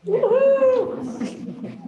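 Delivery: high, drawn-out whoop; high-pitched cheer; excited woohoo. Real, not acted. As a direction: let out a celebratory woohoo. Class cheer after arm demonstration.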